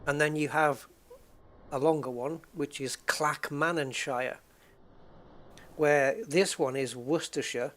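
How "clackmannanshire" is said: Clackmannanshire ending in a full "shy-er"; full shire ending. Shown as a demonstration. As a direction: slowly say Clackmannanshire with "shire" rhyming with fire. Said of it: "you can have shire in places".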